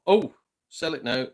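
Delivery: ordinary talk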